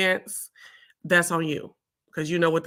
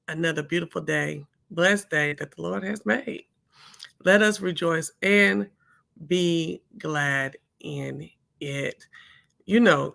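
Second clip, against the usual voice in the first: ordinary talk, nothing marked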